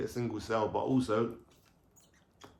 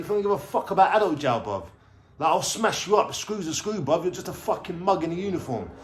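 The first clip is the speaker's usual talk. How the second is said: higher, mock-aggressive snarl at the guard; sneering threat, pitched up and pushed; retold angry threat; 6 semitones higher, 4 dB louder than usual. Young prisoner threatening a prison guard, retold angry threat. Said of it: "I'm like".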